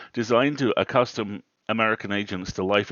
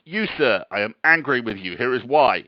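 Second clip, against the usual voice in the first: mock formal anger; 5 dB louder than usual. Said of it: "you've gone".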